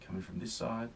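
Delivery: normal talking voice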